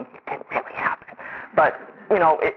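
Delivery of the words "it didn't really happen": imitated whisper, almost no voice; hushed denial. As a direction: breathe it out like a secret you want buried. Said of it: "my mother's going".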